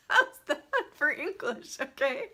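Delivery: laughing through the words at her own phrasing. Real, not acted. To the live viewers, laughing at her own clumsy sentence. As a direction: giggle it out, amused at yourself.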